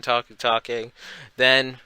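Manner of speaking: normal talking voice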